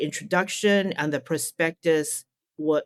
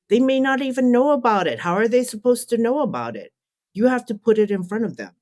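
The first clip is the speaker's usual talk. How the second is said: higher, louder, fast insistence; emphatic retold advice; 6 semitones higher, 4 dB louder, faster than usual. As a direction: drive the point home, pressing fast. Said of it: "She said".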